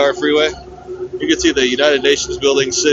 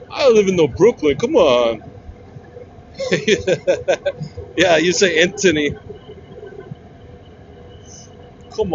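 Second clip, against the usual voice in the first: put-on New Jersey Italian accent; 2 semitones higher than usual. Vlogger imitating the New Jersey Italian coworker, a Jersey accent. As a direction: play the Jersey Italian guy bragging about home. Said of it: "classic Italian, New Jersey".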